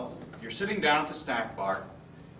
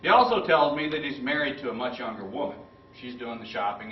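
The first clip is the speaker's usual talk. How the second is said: normal talking voice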